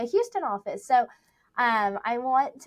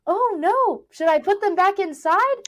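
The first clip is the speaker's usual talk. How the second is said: high worried imitation; 8 semitones higher, 7 dB louder than usual. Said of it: "And he's like".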